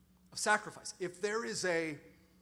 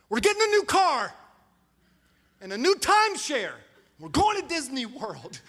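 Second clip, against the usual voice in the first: much higher and louder, giddy announcing; manic splurge; 7 semitones higher, 9 dB louder than usual. Retold or imitated. Husband announcing a wild spending spree to his wife, giddy and loud. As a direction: burst out like you just won the lottery. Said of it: "I wake up, I'm like".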